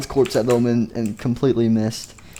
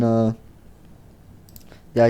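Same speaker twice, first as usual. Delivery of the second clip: ordinary talk, nothing marked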